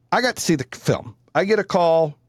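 ordinary delivery

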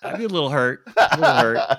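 chuckling through the words; laughing while talking. Real, not acted. Laughing at being hurt.

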